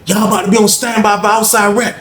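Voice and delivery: hoger, omroepende cipier; guard announcing. Retold or imitated. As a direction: kondig het aan als bewaakster. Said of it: "Lady said".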